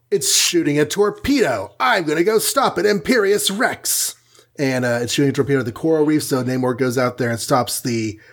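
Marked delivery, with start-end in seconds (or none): 0.1-4.2 high, hammed-up superhero declaration; mock-alarmed hero charging into action; heroic battle cry. Namor's heroic cry at torpedo.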